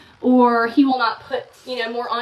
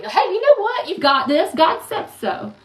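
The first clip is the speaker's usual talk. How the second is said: bright, higher, chirpy comforting voice; mock cheery reassurance; 6 semitones higher, 4 dB louder than usual. Mocking well-meaning friends with a chirpy you've got this. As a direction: brush off the grief with breezy cheer. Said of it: "they're, like, gaslighting you".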